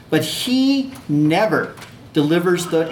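"never" drawn out slowly, set apart; stress on never. Shown as a demonstration. Teacher quoting the devil's accusation, stress on "never". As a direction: lean hard on "never", stretching it slowly. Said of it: "I emphasize the word never".

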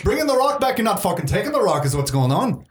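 high, mildly angry disbelief; irate cave woman. Acted. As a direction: question them as a suspicious cave dweller. Voices Fiona questioning their plan.

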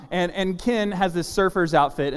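usual speaking voice

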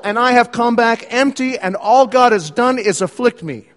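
self-absorbed whine. Acted. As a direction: brush off Ruth and drag it back to me. Naomi voiced, impatient and self-absorbed.

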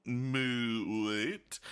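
drawn-out snooty disbelief, rising on the end; mock snooty. Mimicking people who didn't grow up with bologna, mock snooty. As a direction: imitate a snob baffled by the food. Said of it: "And, like, very snooty".

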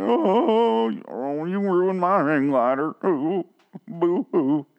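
high, drawn-out fake sobbing lament; boo-hoo it like a hammed-up crybaby; slow, mocking whine of grief; mock wailing. Mock lament for downed hang glider.

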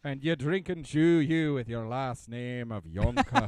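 slow, loud stage-Irish teasing; drawn-out, hammed-up Irish voice; mock Irish accent. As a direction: scold playfully in a fake Irish brogue.

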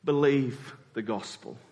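ordinary delivery

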